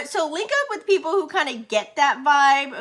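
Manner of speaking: usual speaking voice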